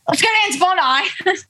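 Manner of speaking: high-pitched, exaggerated Aussie impression; overdone Australian accent. Shown as a demonstration. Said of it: "it's really overdone".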